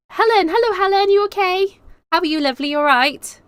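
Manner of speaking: high, loud delighted hello; excited greeting. Real, not acted. Streamer greeting Helen in the chat, excited. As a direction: sing out a welcome to a friend, pitched well up. Greeting a viewer who joins.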